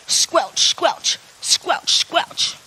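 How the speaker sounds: whispered squelching boot sound; breathy squelch like wet boots; rain patter sounds. Shown as a demonstration. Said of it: "What about this one?".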